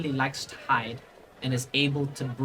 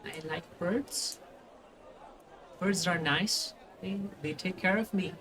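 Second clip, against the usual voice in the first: put-on soft, slightly higher child voice; shy child; 5 semitones higher, 7 dB quieter than usual. Child explaining his mask to the party, soft child voice. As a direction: speak small and quiet, with pauses. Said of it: "and he just goes".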